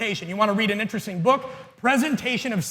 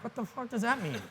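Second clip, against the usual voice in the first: muttered aside; 2 semitones higher, 13 dB quieter than usual. Retold or imitated. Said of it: "And I'm like".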